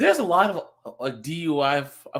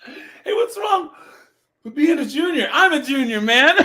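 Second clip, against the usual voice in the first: mock offended whine; 12 semitones higher, 4 dB louder than usual. Mock offence at "junior" jab.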